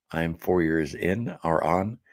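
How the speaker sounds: ordinary talk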